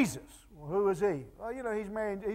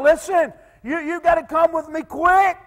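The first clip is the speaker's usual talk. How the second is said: urgent plea; 7 semitones higher, 8 dB louder than usual. Acted. Father pleading with Jesus.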